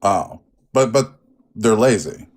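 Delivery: normal talking voice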